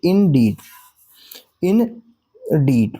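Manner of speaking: indeed with the "deed" stressed and drawn out; light "in", then weighted "deed" in indeed; stress on second syllable. Shown as a demonstration. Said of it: "डीड में हम stress कर रहे हैं".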